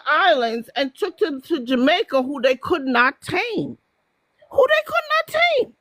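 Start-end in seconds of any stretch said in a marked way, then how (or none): 4.5-5.7 much higher and quicker; high emphatic repeat. Real, not acted.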